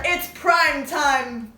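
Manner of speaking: slow, drawn-out mock villain line; quoting Freddy. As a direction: stretch it out like a sly killer. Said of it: "he goes".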